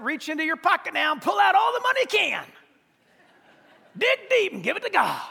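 mock televangelist shouting for cash; mock pushy fundraiser. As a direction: ham up a greedy TV preacher begging. Mock greedy preacher demand.

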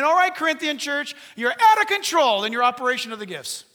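loud, high telling-off; stern rebuke. Acted. Paul scolds the Corinthian church, loud and stern. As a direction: call the whole church to order. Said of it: "Paul is saying".

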